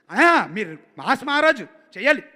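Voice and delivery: loud, pleading pitch; eager director. Retold or imitated. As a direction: push the star to go mass. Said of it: "బాగా imitate చేస్తావు". (Director హీరోని బతిమాలడం)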